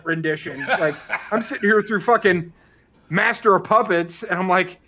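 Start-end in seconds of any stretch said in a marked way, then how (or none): none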